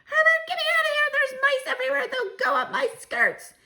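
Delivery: very high, loud, fast hysterical scream; shrill screaming wife. Acted. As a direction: shriek in terror of the mice. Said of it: "Missus Jenkins' shrill voice".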